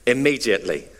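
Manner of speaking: lightly imitated British coach; British accent. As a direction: finish the demand in a mock British accent. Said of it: "He's British".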